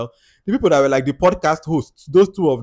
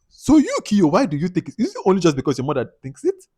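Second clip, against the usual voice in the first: high, louder demands aimed at Kiyo; exasperated questioning; 7 semitones higher, 5 dB louder than usual. Real, not acted. Vlogger scolding the husband on screen, high and exasperated. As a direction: pin him down, fed up.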